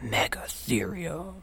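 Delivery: hushed, amazed whisper; awed whisper. Real, not acted. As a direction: whisper the name in awe.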